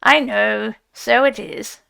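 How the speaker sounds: loud, hasty evasive brush-off; dodging, loud and hurried. A narrator's reading. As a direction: wave the question away too quickly. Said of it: "replied the Rat evasively".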